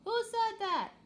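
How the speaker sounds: high, loud alien captain's yell. Acted. Poglite captain to an unseen voice, loud high yell.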